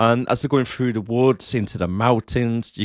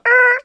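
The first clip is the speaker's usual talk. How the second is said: short, high, loud whistle noise; imitated whistle; 8 dB louder than usual. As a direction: mimic a sudden shrill whistle with the voice. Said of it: "as soon as the whistle, it was like".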